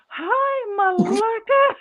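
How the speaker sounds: very high, slow innocent greeting; naive drunk greeting. Retold or imitated. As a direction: squeak it out like a clueless drunk. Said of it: "he's all".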